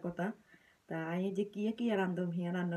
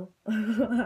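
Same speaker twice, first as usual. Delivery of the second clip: laughing; 4 semitones higher, 8 dB louder than usual. Real, not acted. Laughs at own dialect attempt.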